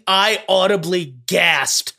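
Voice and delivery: normal talking voice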